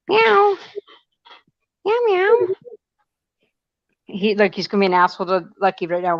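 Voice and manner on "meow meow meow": high squeaky meows aimed at the cat; high mock meow. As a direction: meow back at the kitty, way up high. Meowing at the cat.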